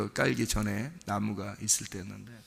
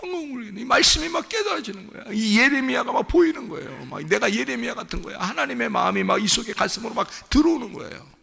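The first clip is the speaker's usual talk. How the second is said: tearful preaching; 12 semitones higher, 5 dB louder than usual. Real, not acted. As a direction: voice rising high and breaking into tears. Preacher weeps recalling God's tears. (울먹이며 높아지는 설교)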